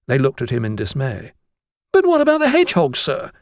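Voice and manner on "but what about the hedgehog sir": very high, fast schoolboy protest; Jennings protesting. A narrator's reading. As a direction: object urgently to the teacher, voice shooting up. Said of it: "Jennings protested".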